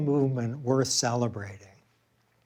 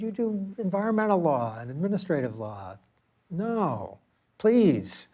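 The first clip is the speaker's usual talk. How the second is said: mother's dismissive plea; 5 semitones higher than usual. Retold or imitated. Mother dismissing his interest.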